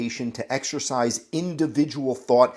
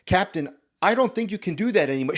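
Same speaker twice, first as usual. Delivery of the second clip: higher, quicker interjection; timid objection; 7 semitones higher, faster than usual. Acted. Corporal chiming in to challenge the captain, higher and hurried. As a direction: chime in with a raised pitch. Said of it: "You chime in".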